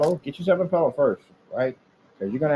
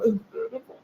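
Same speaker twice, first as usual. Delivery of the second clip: high, hammed-up tearful awe; imitating a hater crying at beauty; mock crying; 9 semitones higher than usual. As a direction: blubber over the build through a laugh. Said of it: "Tears be coming all down".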